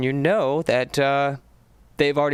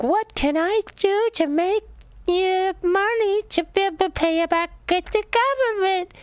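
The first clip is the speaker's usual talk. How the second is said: very high, squeaky mock voice of a broke graduate; mock whiny grad. Mocking a broke graduate's thought.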